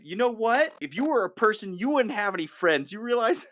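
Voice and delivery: mock bullying the dog. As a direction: needle the dog like a schoolyard bully. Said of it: "And he's like".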